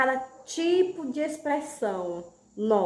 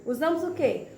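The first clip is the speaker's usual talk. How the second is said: usual speaking voice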